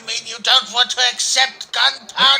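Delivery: sudden loud, affronted demand; bully him into taking the horse; indignant outburst. Acted. Van Ripper forcing the old horse on Ichabod, loud and affronted.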